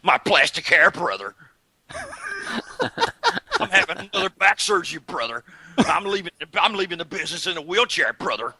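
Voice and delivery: mock the wrestler's woes; mock Hogan voice. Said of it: "don't say brother".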